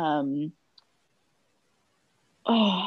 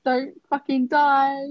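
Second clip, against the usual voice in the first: playful warning; 8 semitones higher, 3 dB louder, slower than usual. Real, not acted. Playful farewell.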